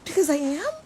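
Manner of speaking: high, loud rising retort; baffled, upturned comeback; incredulous. Retold or imitated. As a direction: state the obvious in disbelief. Deadpan-baffled answer to silly remark.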